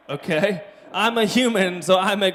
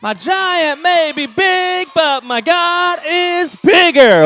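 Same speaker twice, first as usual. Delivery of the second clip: repeating louder and sillier; 6 semitones higher, slower than usual. Shown as a demonstration. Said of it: "Say it as loud as you can".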